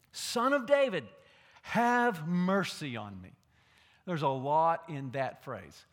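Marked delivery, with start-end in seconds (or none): 0.1-3.3 loud pleading cry. Retold or imitated. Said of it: "he cries out".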